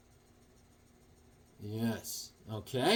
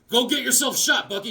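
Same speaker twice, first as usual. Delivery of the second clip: high-pitched mock superhero order; Captain America; 8 semitones higher than usual. Captain America sending his sidekick into gunfire, high comic voice. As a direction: send the sidekick off in a raised put-on voice.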